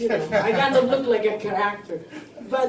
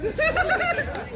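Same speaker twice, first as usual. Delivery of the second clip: high giggle. Real, not acted. Laughs after joke.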